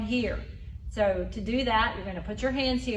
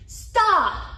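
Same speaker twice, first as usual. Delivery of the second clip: higher, louder annoyed "Stop"; frustrated stop; 9 semitones higher, 6 dB louder than usual. Shown as a demonstration. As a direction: tell the pest to quit it. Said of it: "You're frustrated".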